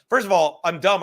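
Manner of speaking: ordinary talk, nothing marked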